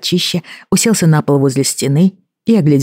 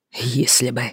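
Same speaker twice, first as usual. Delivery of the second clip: gritted teeth, much softer; through gritted teeth; 2 semitones lower, 8 dB quieter than usual. Acted. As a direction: squeeze it out through clenched teeth. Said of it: "сквозь зубы процедил здоровяк".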